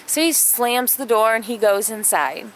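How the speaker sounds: ordinary talk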